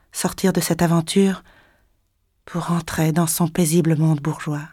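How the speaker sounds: usual speaking voice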